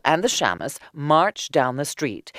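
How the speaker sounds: plain reading voice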